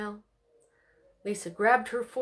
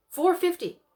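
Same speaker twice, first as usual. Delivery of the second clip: eager answer; 10 semitones higher, 6 dB louder than usual. A narrator's reading.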